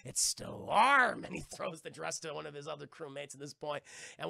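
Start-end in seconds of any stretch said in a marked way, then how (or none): 0.1-1.3 high, loud, drawn-out pirate relish; hammed-up lecherous captain; Barbossa. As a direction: stretch the last word out gleefully. Barbossa leering over the dress.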